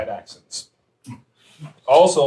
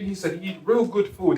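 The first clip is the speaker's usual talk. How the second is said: ordinary talk, nothing marked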